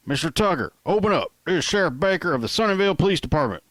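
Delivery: loud, high, fast call through the door; loud sheriff's call. Acted. Sheriff shouting through the apartment door, loud and fast. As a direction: bang out the order like a cop at the door. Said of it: "I could hear the loud voice".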